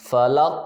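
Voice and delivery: فلق ending in a bouncing, echoed ق; qalqala echo. Shown as a demonstration. Teacher demonstrating qalqala on the final qaf. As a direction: let the ق in فلق bounce twice. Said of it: "وہ آواز repeat ہوئی ہے".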